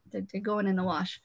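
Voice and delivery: ordinary talk, nothing marked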